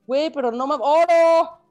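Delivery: high, loud disbelief on oro; exasperated outcry. Shown as a demonstration.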